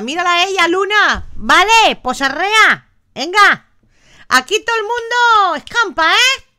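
high, loud mock scolding; mock indignation. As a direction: tell them off playfully for skiving. Mock scolding viewers out partying. (regaño fingido, agudo y fuerte)

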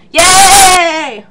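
high, drawn-out yay; happy high squeal; gleeful cheer. Real, not acted. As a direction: celebrate with a stretched cheer. Cheering new party member.

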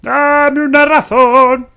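high, loud, drawn-out mock cry; hammed-up shout. Gamer yelling at a game enemy in a mock-dramatic shout. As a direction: bellow it slowly for drama.

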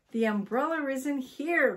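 calling out. A narrator's reading. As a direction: call through to another room. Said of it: "called Mister Twiddle".

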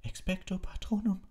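high, straining shout; shouted spell. A narrator's reading. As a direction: cry it with all his strength.

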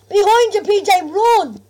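loud, high panicked warning; urgent warning shout. Real, not acted. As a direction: yell to save your mate. Warning teammate of zombies.

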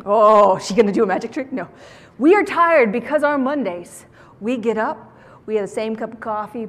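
ordinary delivery

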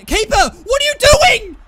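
very loud, high shout at the keeper; furious shout. Real, not acted. Gamer screaming at his wandering keeper, furious shout. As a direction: bellow it in disbelief at the goalie.

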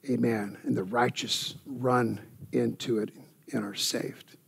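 hushed, breathy reverent whisper. Acted. Preacher drops to whisper.

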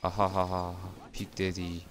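deep pitch shifted. Pretend. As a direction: voice changer dropped way down. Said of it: "내가 음성 변조까지 하면서". (음성 변조된 낮은 목소리)

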